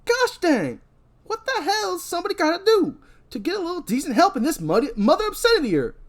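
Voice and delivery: high, loud exasperated rant; Freddy ranting. Acted. Freddy griping that nobody helps him. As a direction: gripe about the useless help. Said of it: "Freddy says".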